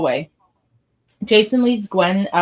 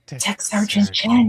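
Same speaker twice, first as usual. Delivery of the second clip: hushed, slightly slow whisper; awed whisper; slower than usual. Acted. The narrator's crew repeats the alien's name, hushed awed whisper. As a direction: whisper the title as if it can't be real. Crew echoes names in awe.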